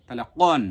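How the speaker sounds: কন from the tongue root against the palate; back of tongue কন. Shown as a demonstration. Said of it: "জিহ্বার গোড়া তার বরাবর উপরের তালুর সঙ্গে".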